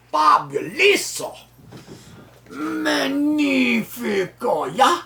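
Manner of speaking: high-pitched, hammed-up Italian; mock Italian accent. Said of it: "not an Italian".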